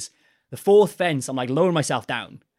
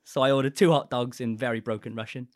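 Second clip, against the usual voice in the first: normal talking voice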